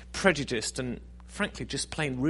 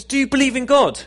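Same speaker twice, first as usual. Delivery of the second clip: urgent woman; 12 semitones higher, 11 dB louder than usual. Acted. Woman urgently questions suicidal man.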